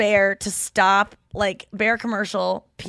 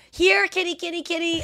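calling a cat; 8 semitones higher than usual. Retold or imitated. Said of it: "God, send me a sign that this cat".

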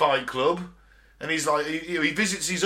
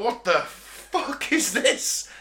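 higher, drawn-out mock alarm; freaked-out disbelief; 7 semitones higher, much slower than usual. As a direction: stretch it out slowly as if shocked by the cult. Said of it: "the narrator's freaking out".

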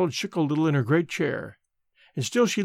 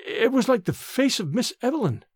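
put-on trembling girl; 7 semitones higher than usual. A narrator's reading.

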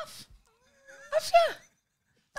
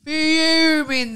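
ordinary talk, nothing marked

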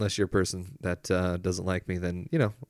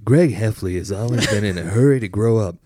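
big movie trailer narration; trailer voiceover; 2 semitones higher, 8 dB louder than usual. Acted. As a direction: announce it like a trailer.

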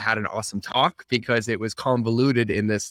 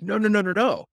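high, quick run of no's; emphatic refusal; 9 semitones higher than usual. Retold or imitated. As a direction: shut the idea down fast and high. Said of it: "They're like".

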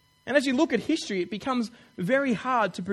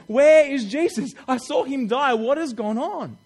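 slow, loud, anguished pleading; distressed cry; 5 semitones higher, 6 dB louder, slower than usual. Acted. Women at the empty tomb asking where Jesus is, slow anguished cry. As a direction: cry out as if the body is missing.

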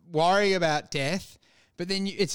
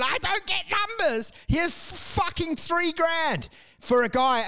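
much higher, wailing mock sob over lost invoices; hammed-up teary whine, pitched way up; mock crying Cheryl; 10 semitones higher than usual. The accountant Cheryl, panicking about invoices, in mock crying. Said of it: "And she's crying".

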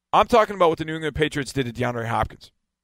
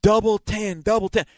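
higher-pitched quick call-out; coach's sideline call; 7 semitones higher than usual. Retold or imitated. Belichick mic'd up calling the coverage, imitated call-out. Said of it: "you could see him".

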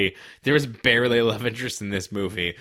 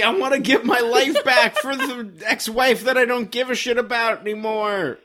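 mock hero monologue; 9 semitones higher, 3 dB louder than usual. Mock hero's inner voice.

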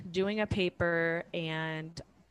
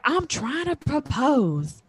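higher, slow, earnest husband voice; husband's plea; 8 semitones higher, 3 dB louder, slower than usual. Retold or imitated. Husband on one knee, pleading slowly in a higher voice. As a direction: plead it out slowly like a flustered fiancé. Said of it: "He was like".

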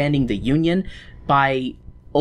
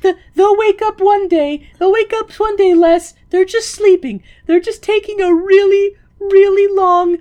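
much higher, louder, sing-song soothing; high mock-soothing voice; 7 dB louder than usual. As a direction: coo it like a bedtime lie. Mock tender consoling voice.

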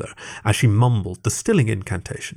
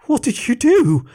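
high, panicked sputtering; 13 semitones higher than usual. A narrator's reading. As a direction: blurt it out in panic. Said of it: "sputtered Greg".

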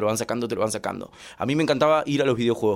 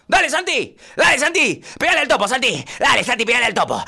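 high, loud frantic shouting; over-zealous dad shouting at an arcade game; father cheering on; 10 semitones higher, 6 dB louder than usual. Acted. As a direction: yell rapid-fire encouragement at your son. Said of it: "el papá de Santi empieza a incentivarlo".

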